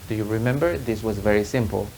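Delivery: usual speaking voice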